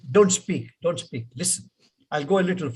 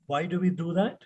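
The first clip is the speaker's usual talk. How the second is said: ordinary delivery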